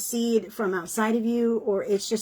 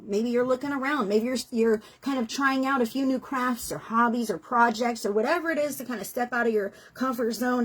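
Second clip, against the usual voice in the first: usual speaking voice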